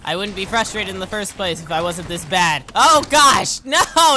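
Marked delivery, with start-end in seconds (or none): 2.8-4.2 sudden loud dismayed cry; startled yelp. Real, not acted. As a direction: yelp as the jump fails. Game fail reaction.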